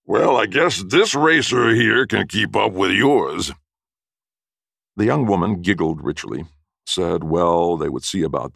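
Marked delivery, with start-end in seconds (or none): 0.0-3.5 loud, slow, higher-pitched challenge; big, drawn-out loud brag; loud boast. A narrator's reading. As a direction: boom it out slowly to drown someone out. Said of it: "drowned out by his partner's loud".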